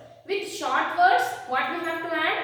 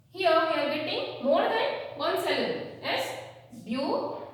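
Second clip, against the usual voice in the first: normal talking voice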